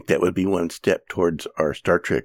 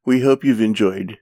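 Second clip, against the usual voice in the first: announcer; 4 semitones higher than usual. Acted. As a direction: wrap up the show like a host. Podcast sign-off.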